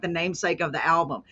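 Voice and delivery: ordinary delivery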